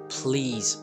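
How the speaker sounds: ordinary delivery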